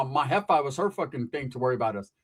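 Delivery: ordinary delivery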